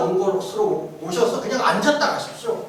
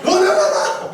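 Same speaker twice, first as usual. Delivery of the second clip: laughing blunt shout; 9 semitones higher, 4 dB louder, slower than usual. Retold or imitated. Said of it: "가끔 반말합니다".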